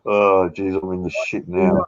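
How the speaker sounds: ordinary talk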